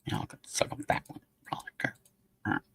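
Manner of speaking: fast unvoiced whisper under his breath. Real, not acted. Streamer whispering to himself while tired, hushed. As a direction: mutter it away to yourself, barely audible.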